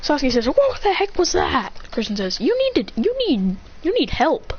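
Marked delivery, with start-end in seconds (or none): 0.5-1.7 high, drawn-out mock outrage; hammed-up shocked complaint; indignant disbelief. Acted outraged reaction in fight. 2.4-4.5 high-pitched mock exasperation; acted fed-up insult; exasperated scolding.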